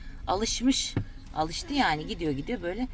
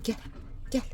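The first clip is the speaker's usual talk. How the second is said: normal talking voice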